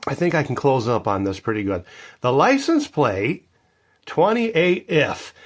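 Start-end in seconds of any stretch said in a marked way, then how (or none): 2.2-5.3 slow read-out. Real, not acted. Reading out plate slowly.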